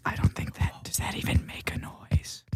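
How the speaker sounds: soft ASMR murmur; ASMR whisper. Pretend. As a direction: whisper it for the tingles. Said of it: "A S M R minute".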